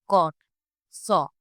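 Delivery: कॉट, सॉ with a long rounded aw; long back aw. Shown as a demonstration. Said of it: "ये एक long back vowel होता है".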